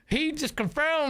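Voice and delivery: slow, playful southern drawl; little southern accent. Pretend. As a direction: put on a light southern American accent. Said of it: "doing a little southern accent".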